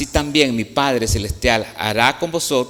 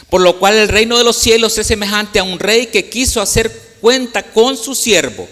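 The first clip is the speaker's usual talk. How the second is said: louder, higher pulpit reading; scripture reading; 9 semitones higher, 8 dB louder than usual. Acted. Preacher reading the parable aloud, louder and proclaiming. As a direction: proclaim the verse to the congregation.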